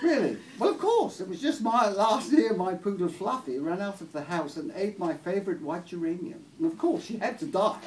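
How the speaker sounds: loud, high, posh English lady; Maggie Thatcher accent. Acted. Posh lady explaining her poodle's fate to a visitor, posh accent. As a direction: justify it grandly in an upper-class accent. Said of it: "posh sort of Maggie Thatcher accent".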